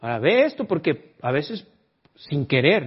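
ordinary talk

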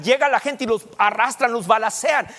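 ordinary talk

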